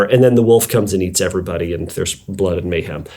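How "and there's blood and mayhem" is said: normal talking voice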